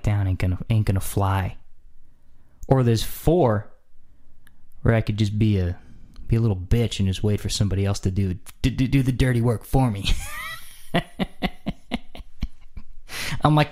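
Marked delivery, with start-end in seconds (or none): none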